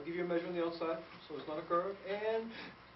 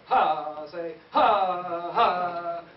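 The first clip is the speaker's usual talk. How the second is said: HA loud and held, pushed with air; big accented HA, demonstrated for a band; breath-push accent; 10 dB louder, much slower than usual. Shown as a demonstration. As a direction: push each HA hard from the breath. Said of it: "It's a little breath push".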